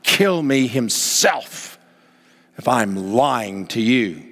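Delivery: very slow, weighty oath; lightly voiced vow; slow solemn oath. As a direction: drag out each word of the oath. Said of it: "It is say".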